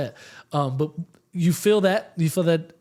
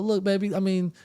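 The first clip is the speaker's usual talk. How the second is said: teasing comic; 4 semitones higher, 7 dB louder than usual. Retold or imitated. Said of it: "He's like".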